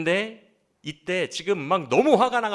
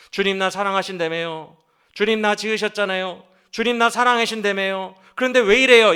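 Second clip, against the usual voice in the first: lamenting prayer; 4 semitones higher, 7 dB louder than usual. Retold or imitated. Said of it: "인정하면서 탄식하고".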